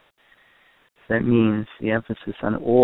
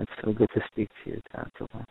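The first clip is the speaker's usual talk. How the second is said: softer, trailing into a whisper on Tantala; tender hush; 8 dB quieter than usual. Shown as a demonstration. Parent to faraway child, dropping to a tender whisper. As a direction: hush it lovingly, like a goodnight. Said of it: "the warmth in your voice".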